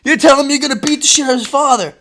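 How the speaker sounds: high, loud, incredulous; amazed kid. Retold or imitated. The boy hearing his dad threaten the bully, loud amazed disbelief. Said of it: "I'm like".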